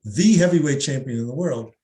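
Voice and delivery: "the" said as thee before heavyweight; emphatic "thee" for the one and only. Shown as a demonstration. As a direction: stress "the" and say it "thee". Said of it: "I've pronounced "the" in a different way".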